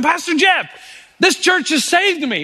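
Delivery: high and slow, full of gratitude; grateful celebration. Retold or imitated. Grateful man celebrating with his pastor, moved. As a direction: thank him with joy. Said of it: "He wanted me to celebrate with him".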